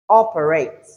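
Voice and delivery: "operate" with O punched, then falling; OPerate, front-stressed; stress on first syllable. Shown as a demonstration. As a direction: hit the O of "operate". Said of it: "pay attention to where you have the stress".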